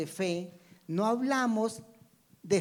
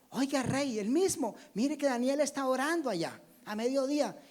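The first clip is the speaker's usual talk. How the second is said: higher-pitched mock tattling to the king; tattling informers; 7 semitones higher than usual. Accusers telling the king on Daniel, higher-pitched mock tattling. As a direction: lightly act an informer reporting someone. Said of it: "Cuando a Daniel le dijeron".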